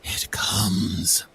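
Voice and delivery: slow, ominous voice in the head; ominous familiar voice. Acted. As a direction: speak slowly like a presence inside their minds. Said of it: "a very familiar voice".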